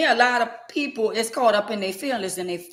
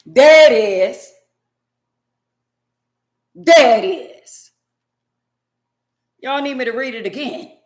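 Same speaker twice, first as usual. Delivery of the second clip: triumphant; 6 semitones higher, 10 dB louder than usual. Real, not acted. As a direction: crow it out like you caught them. Triumphant reveal after the verse.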